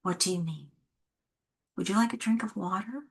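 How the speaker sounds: put-on softly and gently, offering the child help; a quiet, caring voice for an upset child; soft reassuring teacher. Shown as a demonstration. Teacher softly reassuring a crying child, gentle and quiet. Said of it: "here's what I lead with".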